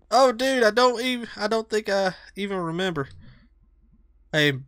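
usual speaking voice

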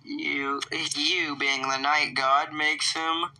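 normal talking voice

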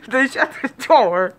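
words squeezed out through laughter; laughing. Real, not acted. Retelling the cousin's antics to viewers, through laughter. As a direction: laugh while asking why.

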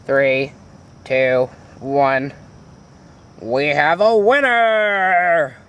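drawn-out winner announcement; slow game-show announcer. Acted.

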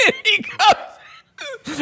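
words squeezed high through laughter; helpless laughter. Real, not acted. Storyteller cracking up mid-story, high laughing voice. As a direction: try to speak while cracking up, slowly.

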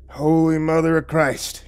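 high, slow exasperated oath; spat out, exasperated. Acted. Lawman fed up with stranger's question, exasperated. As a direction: spit out the oath in disbelief. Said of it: "he spat".

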